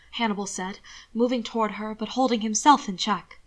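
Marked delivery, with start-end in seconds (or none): none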